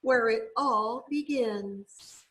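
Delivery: ordinary delivery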